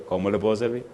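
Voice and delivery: soft, toned-down repeat of the question; subdued phone enquiry. Retold or imitated. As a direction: ask quietly, holding back.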